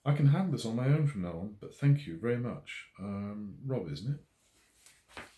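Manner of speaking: a woman voiced to be rid of him, courteous; politely dismissive. A narrator's reading. Jessica politely dismissing Rob.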